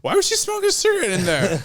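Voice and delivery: high, loud, fast questioning; baffled. Real, not acted. Man puzzling over the woman in trash, incredulous. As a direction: blurt it out in disbelief.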